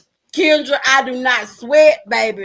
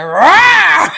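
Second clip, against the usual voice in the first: a loud, higher playful rawr; mock tiger roar; 7 semitones higher, 9 dB louder than usual. Telling viewers the cat turned wild, loud playful rawr. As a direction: growl like a tiger, hammed up. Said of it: "that bitch said".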